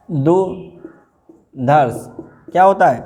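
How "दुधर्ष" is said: दुधर्ष stretched out very slowly; दुधर्ष, drawn out; slow dictation. Shown as a demonstration. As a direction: spell out दुधर्ष slowly for writing. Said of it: "ये लिख दिए".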